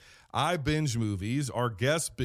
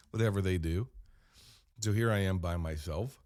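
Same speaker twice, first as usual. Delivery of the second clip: ordinary delivery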